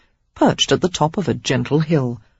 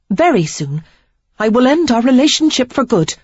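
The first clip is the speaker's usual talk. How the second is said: put-on higher, louder, firm young woman's voice; earnest higher demand, pressing hard; Sarah, resolute ultimatum; 6 semitones higher, 4 dB louder than usual. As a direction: lay down the ultimatum.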